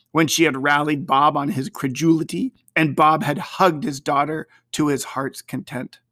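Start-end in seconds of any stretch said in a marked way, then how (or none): none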